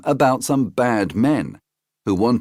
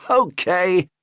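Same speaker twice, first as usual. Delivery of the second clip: higher, eager uncle; a high, eager storybook uncle; high Uncle Jack voice; 11 semitones higher than usual. Acted. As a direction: pitch up into the friendly uncle.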